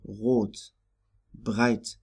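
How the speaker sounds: rot and breit with the R said as in French; French-style R. Shown as a demonstration. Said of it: "is pronounced like in French".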